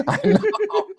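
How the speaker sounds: laughing it off, voice up high. Real, not acted. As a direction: laugh through the words. Laughing after Al's jab.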